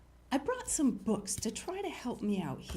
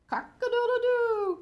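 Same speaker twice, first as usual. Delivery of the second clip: loud, very high crowing; ringing cock-a-doodle call; rooster crow; 14 semitones higher, 9 dB louder than usual. A narrator's reading. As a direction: crow like a rooster, last note held long. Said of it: "crowed the rooster".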